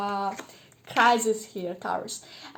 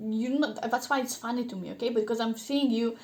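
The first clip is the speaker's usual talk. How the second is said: ordinary talk, nothing marked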